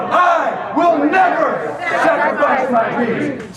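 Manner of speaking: loud slow rallying cry; shouted vow. Shown as a demonstration. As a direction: lead the crowd in a roar. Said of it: "say it with me".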